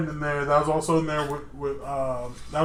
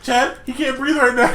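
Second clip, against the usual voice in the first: higher, louder mock alarm; freaking out; 7 semitones higher, 5 dB louder than usual. Classmate tells the teacher her friend can't breathe, mock panic. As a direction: imitate a panicked girl, amused underneath. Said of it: "freaking out".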